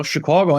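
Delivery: "Chicago" with a rounded "caw"; Royko's Chicago. Shown as a demonstration. Actor explaining the way he said it, "Chicago" as "shi-KWAW-go". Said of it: "It's the way he said it".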